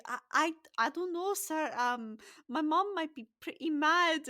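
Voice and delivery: high, stammering hesitation; timid youngster, unsure and worried; wide-eyed nervous boy. Acted. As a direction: stutter anxiously in a boyish voice. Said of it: "looks at it wide-eyed".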